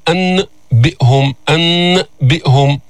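"أنبئهم" slowly, long clear n detached from b; laboured izhar. Shown as a demonstration. Teacher showing why izhar is awkward, "أنبئهم" with held clear n. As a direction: hold annn, then close for bi'hum. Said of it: "هذا عسير، يعني أن تقول".

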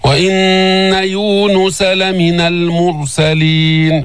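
slow chanted verse, heavy r; ترتيل بطيء; Quran recitation. Shown as a demonstration. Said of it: "فخم الراء".

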